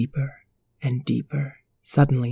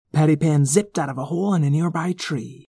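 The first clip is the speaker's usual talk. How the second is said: usual speaking voice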